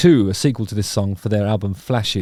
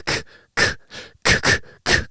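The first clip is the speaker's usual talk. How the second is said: clapping sound. Shown as a demonstration. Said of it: "clapping pattern".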